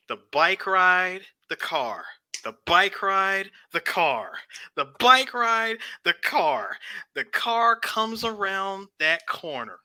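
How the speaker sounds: slow, drawn-out movie-trailer pacing; milk each beat like an overwrought drama; hammed-up slow-motion retelling; mock dramatic retelling. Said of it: "all the effort into doing the dramatic piece".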